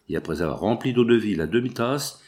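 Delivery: ordinary delivery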